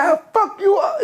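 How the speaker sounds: high-pitched retold anger; mock-angry outburst, pitched high; pissed off. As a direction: imitate him angry, voice jumping way up. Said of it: "He was pissed off".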